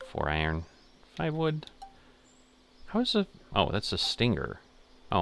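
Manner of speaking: ordinary talk, nothing marked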